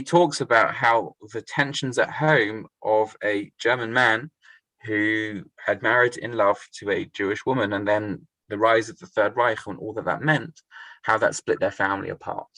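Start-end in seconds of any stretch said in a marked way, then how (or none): none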